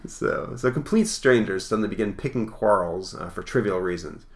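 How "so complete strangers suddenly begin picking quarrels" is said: plain reading voice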